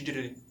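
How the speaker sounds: "Didgeridoo" as a quick didgerly; mastered pronunciation. Shown as a demonstration. Learner showing he can now say it, rapid normal pronunciation. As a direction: say "Didgeridoo" as digitally, fluently run together. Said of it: "hoje eu consigo falar perfeitamente".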